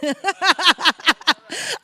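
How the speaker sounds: loud, high burst of real laughter; helpless cackle at oneself; laughing. Real, not acted. Speaker apologizing for a wandering mind, loud laugh.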